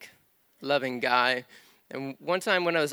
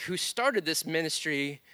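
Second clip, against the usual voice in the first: normal talking voice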